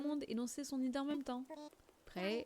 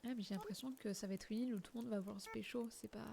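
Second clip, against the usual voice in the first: ordinary delivery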